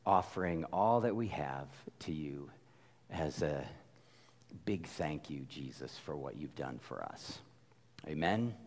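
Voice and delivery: quiet prayer. Real, not acted. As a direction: pray gently before the sermon. Pastor leads opening prayer.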